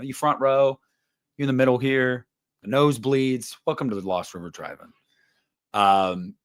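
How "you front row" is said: ordinary delivery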